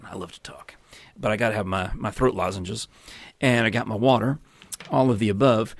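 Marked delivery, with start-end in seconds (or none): 0.0-0.8 breathy whispered joke to himself; whispered aside. Real, not acted. Vlogger jokes to viewers about talking, in a whisper. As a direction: whisper it like a little confession.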